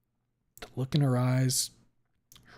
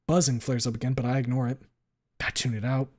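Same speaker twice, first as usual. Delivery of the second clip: ordinary delivery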